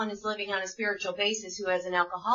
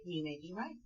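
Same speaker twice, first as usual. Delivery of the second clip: calm concession; 3 semitones lower, 12 dB quieter than usual. Shown as a demonstration. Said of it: "talks about how we can say".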